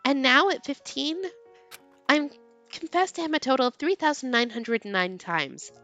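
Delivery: ordinary delivery, nothing marked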